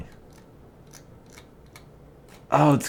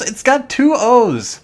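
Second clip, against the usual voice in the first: slow, higher, louder; stressed, drawn out; 11 semitones higher, 8 dB louder, slower than usual. Real, not acted. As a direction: stretch it out with mock disbelief. Mocking the audition's "too slow.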